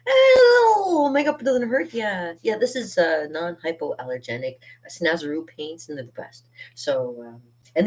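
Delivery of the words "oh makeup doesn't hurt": high, loud mock voice of a carefree clown. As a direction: toss it off like a cheerful clown. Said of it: "so it's kind of like".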